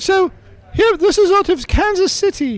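very high, squeaky bunny puppet voice; high-pitched cartoon rabbit; Sid. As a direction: play a talking rabbit way up high. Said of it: "in Sid's voice".

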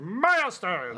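drawn-out booming announcement; announcer call. Pretend. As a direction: call it out like a show segment. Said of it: "You used to yell. You don't yell anymore".

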